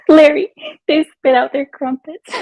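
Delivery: high, loud through laughter; mock-horrified Brit; laughing posh Brit.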